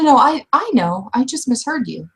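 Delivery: ordinary delivery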